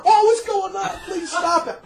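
high alarmed pleading nun; mock pleading nun. Nun running back begging them to stop, high alarmed.

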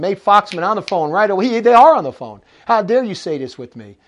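ordinary talk, nothing marked

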